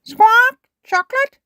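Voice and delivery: very high, loud squawk; parrot mimicry. A narrator's reading. Polly copies Susie's order, high parrot squawk. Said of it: "Polly Parrot copied".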